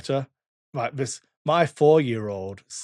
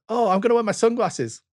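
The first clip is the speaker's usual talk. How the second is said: Evie; 9 semitones higher, 3 dB louder than usual. Retold or imitated. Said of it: "she runs through her back door".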